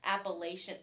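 "Appalachian" with a long A, LAY-shun; northern pronunciation. Shown as a demonstration. Teasing how outsiders say it, "Appalachian" with LAY. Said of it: "she says Appalachian".

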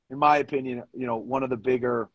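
ordinary talk

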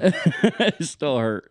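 laughing through the words. Real, not acted. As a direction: giggle it out slowly. Laughing while retelling pain.

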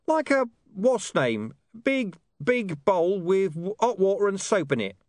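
high, gruff dog voice with a knowing air; a streetwise dog explaining things. Acted. Deep Bone groping for word.

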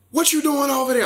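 high-pitched mock of a woman's phone voice; imitating her put-on tone. Mimicking a woman he phoned, high put-on tone. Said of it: "this, this, this tone".

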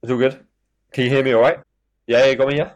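calling out over a bad line. Real, not acted. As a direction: speak up, call over a laggy call. Checking a bad call line.

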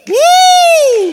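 high, loud celebratory whoop; big happy party whoop; cheering whoop. Real, not acted. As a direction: cheer her on. Celebrating wife's birthday.

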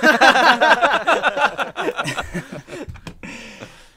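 loud, high belly laugh; long helpless burst of laughter; big laugh. Real, not acted. Podcaster cracking up at the Michael Caine answer, laughing.

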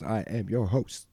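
ordinary delivery

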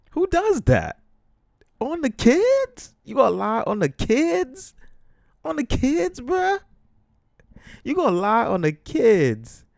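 very high, loud incredulous rant; loud, high-pitched comic indignation; outraged disbelief. Acted. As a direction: cry it out, appalled at the lie. Ranting in disbelief at the lie.

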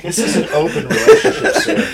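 chuckling while playing the stern official; laughing mock formality. Co-host jokes about the open relationship, laughing in mock formality. Joking reply about friend's questions.

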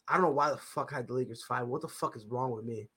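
low, soft self-scolding mutter; muttered disbelief. Real, not acted. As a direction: mutter at yourself, disgusted.

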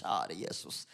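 a hushed plea under his breath; quiet inner prayer. Retold or imitated. Pastor prays silently among rich passengers, hushed.

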